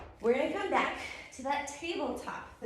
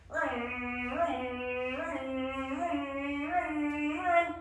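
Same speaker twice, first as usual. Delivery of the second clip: droning, drawn-out motor noise; car engine vroom; 2 semitones higher, much slower than usual. Pretend. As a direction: hum like a rolling engine. Imitates a car reversing.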